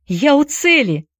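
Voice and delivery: high-pitched excited cry; calling out. A narrator's reading. Boy reaching the tree, high urgent cry. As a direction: report it urgently from afar. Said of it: "закричал Уилл".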